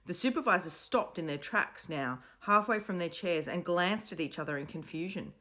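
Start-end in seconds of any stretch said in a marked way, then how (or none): none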